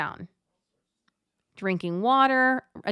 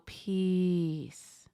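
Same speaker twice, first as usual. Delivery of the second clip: calming word; 3 semitones lower, 5 dB quieter than usual. Shown as a demonstration. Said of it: "I like to say in my mind the word peace".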